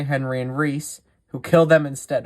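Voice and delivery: ordinary delivery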